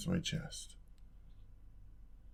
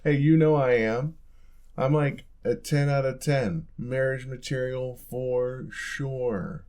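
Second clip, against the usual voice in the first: much louder and higher, cocky and showing off; playful loud boast; 4 semitones higher, 6 dB louder, slower than usual. Acted. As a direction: brag loudly, half-joking, drawing out the last words. Mock boasting to teasing partner.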